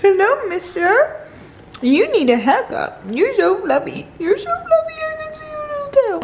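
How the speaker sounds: high cooing pet voice. Real, not acted. Greeting a fluffy dog, high cooing baby talk. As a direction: coo at a fluffy puppy.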